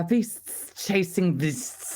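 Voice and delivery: slow, drawn-out tongue-twister; laboriously slow. Shown as a demonstration. Voice actor repeating the tricky line from the outtake, very slowly. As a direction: take your time over each word. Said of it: "hours to say those three words".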